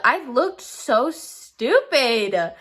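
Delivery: usual speaking voice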